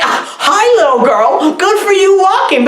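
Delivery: high-pitched, chirpy mock greeting; hammed-up officer greeting a child; friendly police officer. Police officer greeting a lone little girl, mock friendly. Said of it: "this police car comes".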